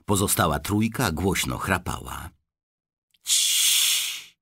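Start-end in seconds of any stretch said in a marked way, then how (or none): 3.2-4.3 hushing whisper so the sleepers stay asleep; breathy shh to the boy; whispered shush. A narrator's reading. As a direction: grandpa whispering shh to Charlie. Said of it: "szepnął dziadek Joe".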